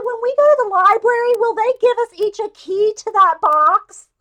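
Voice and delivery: high, loud, fast innocent asking; naive eager question. Retold or imitated. Adult student asking the teacher about an email key, eager. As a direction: ask it wide-eyed and keen. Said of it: "I said".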